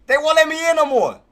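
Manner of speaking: high, loud frustrated outcry; loud complaint. Real, not acted. Trader shut out of his old entry, loud high complaint. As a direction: complain loudly, pitched high.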